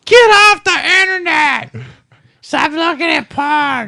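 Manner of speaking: very high, loud and slow scolding; shrill yelling mom. Retold or imitated. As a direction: shriek it like an angry mom. Voicing the stepmom yelling.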